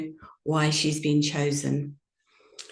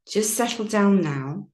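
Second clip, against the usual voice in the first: guided meditation. Acted. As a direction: ease the listeners gently into stillness. Guided yoga nidra meditation.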